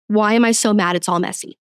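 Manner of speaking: usual speaking voice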